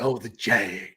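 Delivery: mock posh Jaguar owner. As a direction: play the rich Jaguar owner. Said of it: "'cause you're like".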